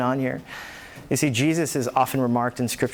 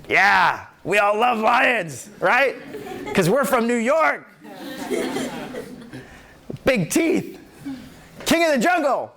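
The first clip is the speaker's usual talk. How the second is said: playful hype; 8 semitones higher than usual. Real, not acted.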